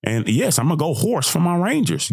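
ordinary talk, nothing marked

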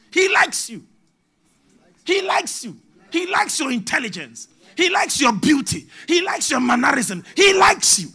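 loud, high, shouting sermon climax; full-force preaching. Acted.

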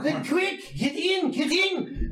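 high, urgent dwarf voice; dwarvish accent. Acted. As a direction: hurry them along as a dwarf. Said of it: "you kinda catch this dwarvish accent".

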